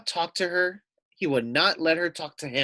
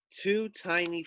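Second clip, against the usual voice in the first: ordinary delivery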